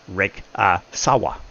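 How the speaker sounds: Rikasawa slowly, broken into separate beats; syllable by syllable. Shown as a demonstration. Explaining how the Japanese speak, Rikasawa in slow separate syllables. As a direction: break Rikasawa up with small gaps between parts. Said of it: "Every single syllable has the same level of enunciation".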